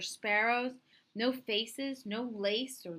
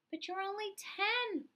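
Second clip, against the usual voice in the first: much higher, pleading mother; worried mother; 12 semitones higher than usual. Acted. Mama protesting her son is too young, high and worried. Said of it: "Mama cried".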